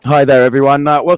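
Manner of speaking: booming into the mic; too loud. Real, not acted. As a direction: greet the room far too loudly. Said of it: "is that too loud?".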